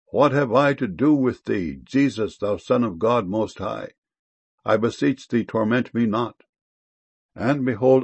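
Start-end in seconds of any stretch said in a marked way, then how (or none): none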